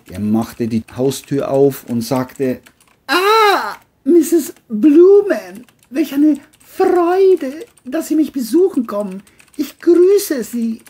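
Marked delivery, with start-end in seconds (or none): 3.1-10.8 high, loud, slow sugary greeting; John fake-friendly. John falsely greets the neighbour mother, high and slow. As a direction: lay on false charm.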